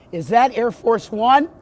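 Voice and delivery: very high, loud excited shout; excited yelling. Real, not acted. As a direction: yell up at the plane in awe. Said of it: "Is he gonna keep yelling like that?".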